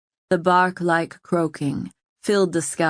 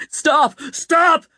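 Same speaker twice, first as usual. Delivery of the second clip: much higher, louder desperate cries; crying in terror; 10 semitones higher, 5 dB louder than usual. A narrator's reading. King crying out as the birds attack, terrified. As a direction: cry out as if being torn apart. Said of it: "the king cried in terror".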